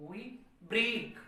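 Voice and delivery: वी light, break punched and stretched; stress on second word. Shown as a demonstration. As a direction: masculine rhyme demo, weak वी then strong break. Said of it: "syllable जो है वो stressed करोगे".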